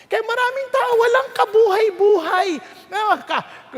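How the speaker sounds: usual speaking voice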